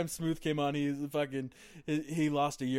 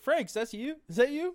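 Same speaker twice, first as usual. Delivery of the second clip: higher excited buddy voice; buddy calling out; 9 semitones higher, 3 dB louder than usual. Retold or imitated. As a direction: call out to an old friend, higher. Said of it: "his buddy's like".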